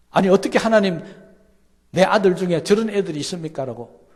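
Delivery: loud, high, aggrieved cry to God; complaining prayer. Retold or imitated. Parent crying out to God in complaint, loud and high. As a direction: protest to God like a hurt parent.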